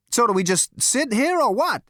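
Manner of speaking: loud, very high, exasperated demand; angry. A narrator's reading. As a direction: snap impatiently at full voice. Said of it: "said Zaphod angrily".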